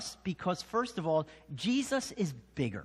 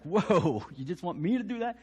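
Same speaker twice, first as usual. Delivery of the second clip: laughing in disbelief, higher; mock-flustered, higher and quick; startled Moses; 3 semitones higher than usual. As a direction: Moses balking at God's order, higher and laughing. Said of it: "And Moses is like".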